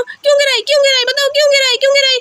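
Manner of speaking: put-on high, repeated childish demands; mock furious little girl; angry child. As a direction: scold little brother while pretending to hit him. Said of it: "Hasnain की पिटाई करने लगती हूँ".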